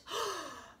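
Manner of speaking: sharp high startled gasp; surprised gasp. Shown as a demonstration. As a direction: suck air in as if startled. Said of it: "think of being surprised".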